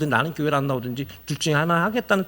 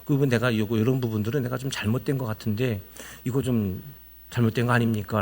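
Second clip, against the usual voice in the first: polite, humble; 5 semitones lower, 6 dB quieter than usual. Shown as a demonstration. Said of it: "공손하게 이야기하세요".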